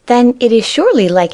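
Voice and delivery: put-on high, eager childlike voice; a baby bunny sure he's guessed it; eager little rabbit. A narrator's reading.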